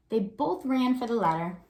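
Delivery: put-on lively read-aloud for young kids; story narration. A narrator's reading. Teacher reads picture book aloud.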